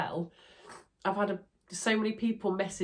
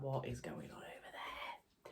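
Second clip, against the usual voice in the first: hushed whisper, slow; 3 semitones lower, 14 dB quieter, slower than usual. Retold or imitated. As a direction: whisper it like a nosy neighbour. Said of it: "My neighbours will be like".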